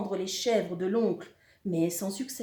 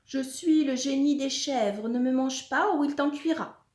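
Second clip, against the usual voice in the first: high, raised voice of warning; pitched-up warning cry; 6 semitones higher, 4 dB louder than usual. A narrator's reading. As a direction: proclaim it loudly to scare off a monster. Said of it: "celui-ci s'écrie".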